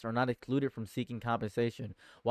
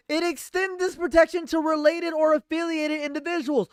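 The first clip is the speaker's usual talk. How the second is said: much higher and louder, a strained squealing rant; outraged; 8 dB louder than usual. Real, not acted. Streamer rants at chat about the news, high and loud. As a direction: lose it in shocked disbelief.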